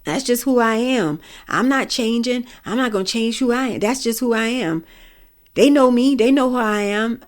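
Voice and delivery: mock stubborn defiance. Speaker imitates people who refuse to change, mock defiant. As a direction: mimic someone digging in and refusing to change. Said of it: "I also hate when people say".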